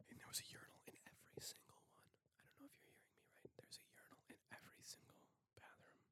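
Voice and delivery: hushed, breathy-voiceless reveal; dramatic whisper. Acted. Telling listeners about the urinals, awed whisper. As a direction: whisper it like a huge secret.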